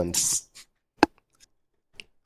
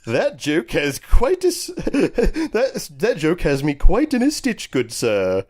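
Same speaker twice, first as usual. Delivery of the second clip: a hint of a posh Englishman, higher and through laughter; hammed-up posh gent; mock-posh reply in a gentleman's voice; posh British gentleman; 8 semitones higher, 4 dB louder, much slower than usual. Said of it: "posh British gentleman".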